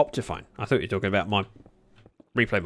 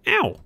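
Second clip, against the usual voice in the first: sharp ow and gasp; 9 dB louder than usual. Real, not acted. Hurt, surprised in game.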